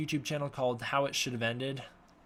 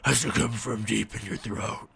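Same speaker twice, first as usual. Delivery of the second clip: guttural growl from deep in the throat; Batman; 3 semitones lower than usual. Shown as a demonstration. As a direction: rasp it like a masked vigilante. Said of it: "That's like a Batman voice".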